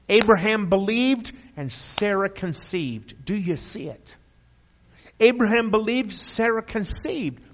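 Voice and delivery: loud, high Abraham believed, Sarah conceived; preaching at full force. Acted. Preacher to his church, loud high triumphant refrain. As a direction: ring it out as a rallying cry.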